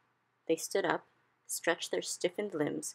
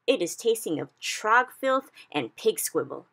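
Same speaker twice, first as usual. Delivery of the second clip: slow, louder disgust; 5 semitones higher, 6 dB louder than usual. A narrator's reading. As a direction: spit out each foul word. BFG disgusted by snozcumber.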